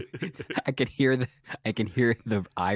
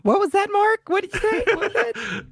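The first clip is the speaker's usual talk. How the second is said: much higher, louder mock-outraged voice; mock indignation; 4 dB louder than usual. Co-host reacts to Barry's joke, high mock outrage. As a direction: squeal it as if appalled.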